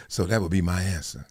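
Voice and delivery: low, soft bland answer; deadpan executive sign-off; quoting Howie. Retold or imitated. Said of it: "He said".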